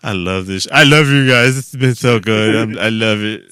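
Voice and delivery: put-on loud, gushing drunk; sloppy drunk gushing at friends; drunk guy. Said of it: "I'm talking like a drunk guy".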